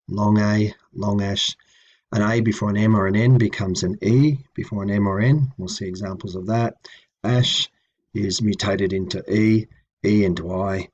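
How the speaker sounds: usual speaking voice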